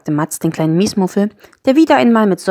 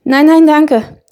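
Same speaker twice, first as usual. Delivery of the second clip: high, loud hurried refusal; hasty cry; 9 semitones higher, 8 dB louder, much slower than usual. A narrator's reading. Matz stops the garlic spell, hasty cry. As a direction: cut in quickly to head it off. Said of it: "rief Matz schnell".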